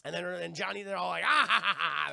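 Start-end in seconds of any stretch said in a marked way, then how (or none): 1.3-2.1 loud, forced ha-ha; hammed-up group cackle; mock laughter. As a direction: imitate a crew roaring with laughter. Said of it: "They're all laughing".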